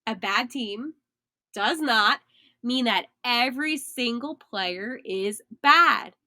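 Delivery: slow repeat with "not" and "bad" leaned on; deliberate slow restatement; stress on not and bad. Shown as a demonstration. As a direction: spell it out, punching "not" and "bad". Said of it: "Let me say that again".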